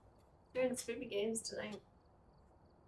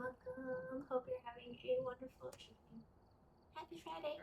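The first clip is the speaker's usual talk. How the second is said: high soft greeting; 13 semitones higher, 8 dB quieter than usual. Acted. Mock stream greeting again.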